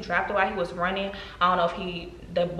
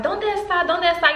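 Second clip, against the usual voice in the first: high, pressing mock shout; imitating yourself yelling at a thief; retold urgent cry; 9 semitones higher than usual. Woman yelling at phone thief, retold urgent cry.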